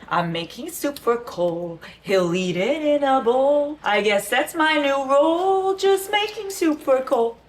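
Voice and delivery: slow, high lament building from normal pitch; self-pitying high whine, hammed up; Phoebe. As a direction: climb into a high, drawn-out wail. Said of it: "where Phoebe's making soup and she's like".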